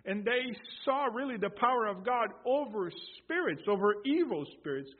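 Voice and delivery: loud, high preaching climax; full-force preaching. Acted. Preacher proclaiming God's power over spirits, loud and ringing.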